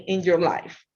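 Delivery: ordinary talk, nothing marked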